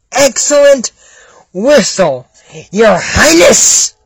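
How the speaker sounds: drawn-out, exaggerated flattery; mocking echo. Listener mocking the guards' praise of the whistle, drawn-out echo. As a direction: parrot the praise word by word, slowly.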